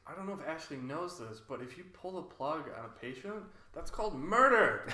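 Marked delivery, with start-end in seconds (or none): 3.7-4.9 loud, higher mock indignation; mock outrage. Joking to his cohost about pulling the plug, loud mock outrage. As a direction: declare it like a shocked prosecutor.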